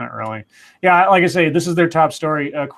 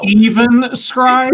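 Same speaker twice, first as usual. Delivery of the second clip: indignant disbelief; 7 semitones higher, 9 dB louder, much slower than usual. Real, not acted. Indignant at being singled out.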